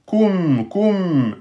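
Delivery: normal talking voice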